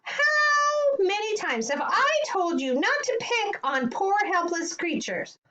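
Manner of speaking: high-pitched telling-off; scolding yell. Acted. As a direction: scold like an exasperated mother. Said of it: "she yelled".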